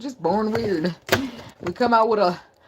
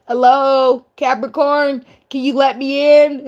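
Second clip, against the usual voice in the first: loud, higher mock pleading call; calling through a door; 6 semitones higher, 7 dB louder, slower than usual. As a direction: holler to be let in. Acts pleader shouting at door.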